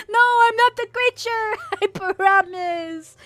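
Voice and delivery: denial pitched far up, stretched slowly at the end; a squeaky, laughing protest; high voice toggle. Pretend. VTuber denying she is the creature, squeaky high protest.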